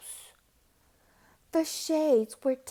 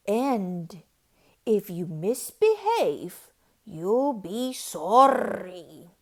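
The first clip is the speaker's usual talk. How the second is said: loud, slow warning, lower; menacing threat; 3 semitones lower, 8 dB louder than usual. A narrator's reading. Strict teacher warning misbehaving kids, loud and slow. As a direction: threaten the class with a slow growl. Miss Swamp's stern classroom orders.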